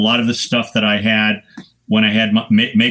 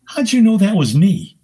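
amazed question; 9 semitones higher than usual. Retold or imitated. Star player asks the photographer, amazed. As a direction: rise up in disbelief.

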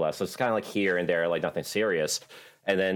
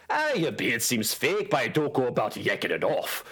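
put-on Scottish dwarf accent; 6 semitones higher than usual. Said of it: "them asking me if I could do a Scottish accent".

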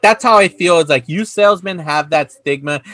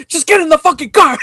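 high-pitched mimicked exasperated order; imitated movie outburst; 10 semitones higher, 3 dB louder than usual. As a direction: imitate the actor's line, pitched way up. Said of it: "the way he says it".

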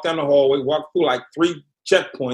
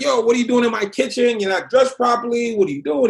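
high-pitched imitation of an irate kitchen boss; mock yelling; 6 semitones higher than usual. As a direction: retell his outburst, pitched up, not truly screaming. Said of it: "He starts screaming at me".

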